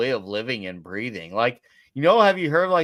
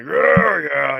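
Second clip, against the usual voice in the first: mock drunken dudes roaring, louder and higher; drunken cheer; 4 semitones higher, 6 dB louder than usual. Imitating drunk dudes after a metal fest, rowdy cheer. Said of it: "all the belligerent dudes being drunk".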